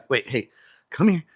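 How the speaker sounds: ordinary talk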